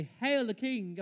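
loud, high hail; crowd's acclamation. Retold or imitated. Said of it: "The same people who say".

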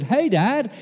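high, loud, eager son bursting in; storming in. Acted. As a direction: call it out as you charge in. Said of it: "Esau comes storming in and says".

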